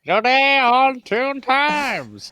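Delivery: high, slow, loud mock announcer; hammed-up high-pitched show opener. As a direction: belt out a drawn-out programme intro.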